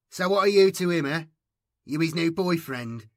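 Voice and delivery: loud, high schoolyard taunt; loud high boy's jeer; jeering bully. A narrator's reading. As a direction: play to the laughing crowd. Craven jeering.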